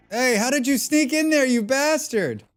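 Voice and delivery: loud, high-pitched outburst; indignant shout of surprise; startled yell. Real, not acted. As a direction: yell at a sneaky attacker. Surprise attack in the game.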